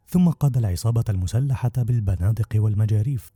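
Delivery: plain reading voice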